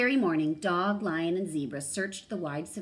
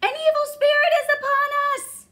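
high, loud, fast panicked cry; panicked shout; 14 dB louder, faster than usual. A narrator's reading. Fleeing animals shout in panic at the ghost. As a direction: yell it while running in terror.

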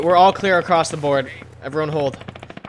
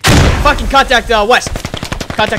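loud alarmed callout; shouting over gunfire; 8 semitones higher, 6 dB louder than usual. Real, not acted. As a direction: yell the contact warning. Enemy contact called out.

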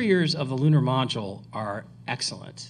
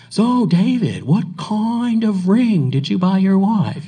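higher, louder, slow put-on voice; doing David's voice; 6 semitones higher, 7 dB louder, slower than usual. Teasing David by imitating him at dinner, slow mock voice. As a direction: mimic him to his face over beers. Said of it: "He's doing you, Dave. He's doing you".

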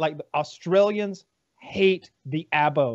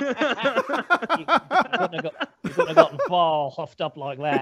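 laughing; slower than usual. Real, not acted. Laughing joke remark.

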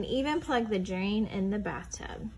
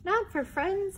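squeaky question; 9 semitones higher than usual. Acted. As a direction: ask again more gently. Repeated take of Mouse line.

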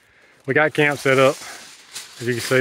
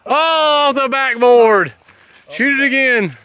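excited; 10 semitones higher, 7 dB louder, slower than usual. Real, not acted. Friend's basketball shot misses.

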